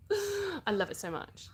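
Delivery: breathless, slow gush of joy; gasp, then gush over it; gasp of delight. Real, not acted. Gasping delight over school trips.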